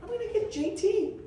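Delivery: breathy start, then jumping high with excitement; eager high-pitched scheming; sudden bright idea. Retold or imitated. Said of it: "I said".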